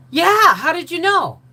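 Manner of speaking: high, loud, slow; surprised reply. Retold or imitated. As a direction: ask in surprise. Surprised Angie knows him.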